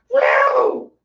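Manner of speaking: very high whooping woo. Real, not acted. Reacting to the shit show, wild whoop.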